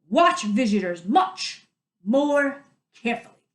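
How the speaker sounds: loud stern reprimand; stern warning. Acted. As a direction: wag a finger with your voice. Mayor shaking finger at guard.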